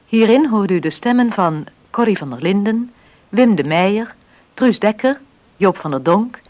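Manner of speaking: normal talking voice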